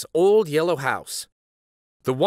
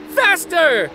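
higher, louder shout; louder, higher shout for more speed; excited shout; 13 semitones higher, 7 dB louder than usual. Acted. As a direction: yell it with full thrill. Child urging papi.

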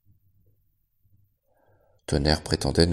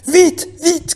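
high frantic shout; 10 dB louder than usual. A narrator's reading. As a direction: yell it in alarm, running. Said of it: "cria-t-il".

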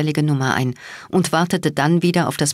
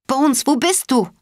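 screaming his name; 9 semitones higher than usual. A narrator's reading. Cat screams into the phone for Bones, panicked. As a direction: scream for him through the static. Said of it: "schrie laut seinen Namen".

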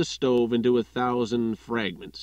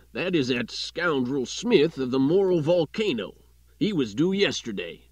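lightly voiced editor; 3 semitones higher, 3 dB louder than usual. Editor spots gunman at window.

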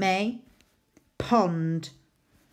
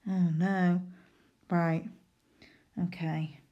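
usual speaking voice

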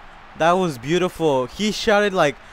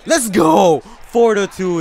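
loud, raised celebratory yell; triumphant shout; 5 semitones higher, 8 dB louder, slower than usual. Real, not acted. Gamer celebrating four to two, triumphant shout. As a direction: shout it in triumph, then punch out the score.

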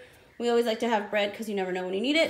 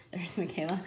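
whisper; 3 semitones lower, 7 dB quieter than usual. Real, not acted. Mother spots daughter mid-haul.

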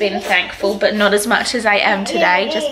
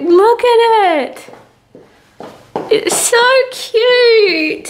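cooing; 11 semitones higher, 6 dB louder, much slower than usual. Real, not acted. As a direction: coo it out high and slow. Mum cooing over baby's outfit.

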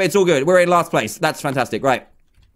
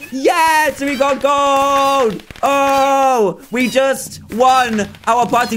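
high, drawn-out victory cheer; overcome with joy; 6 semitones higher, slower than usual. Real, not acted. Player realising he just won gold, ecstatic cheering. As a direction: cry out in elated disbelief. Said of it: "I'm overcome with emotion".

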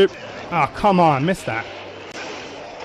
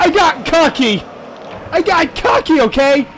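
rueful exclaim; 8 semitones higher than usual. Real, not acted. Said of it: "So cocky!".

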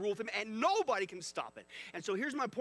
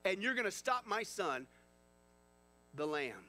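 ordinary talk, nothing marked

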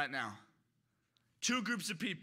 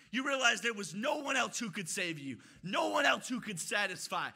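normal talking voice

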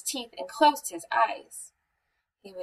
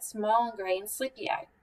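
ordinary delivery, nothing marked

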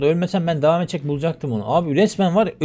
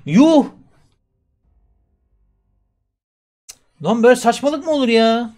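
loud, higher exasperated yelling at the game; gamer rage; 8 semitones higher, 8 dB louder than usual. Real, not acted. Gamer losing his gear, yelling in disbelief. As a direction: blow up at the unfair game.

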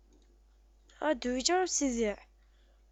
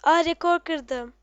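ironic; 3 semitones higher, 8 dB louder, slower than usual. Real, not acted. Sarcastic record comment.

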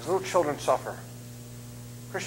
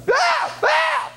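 high shouted mock wail, louder; mock crying wail; 4 dB louder than usual. Preacher crying back at the daycare kid, loud high mock wail. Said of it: "I cried exactly the way this".

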